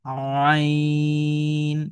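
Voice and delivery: আইন held long, pressed from mid-throat; Arabic throat letter, pressed; pressed throat ain. Shown as a demonstration. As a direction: squeeze আইন out of the throat, sustained. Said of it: "আপনি আমার সাথে বলার চেষ্টা করুন".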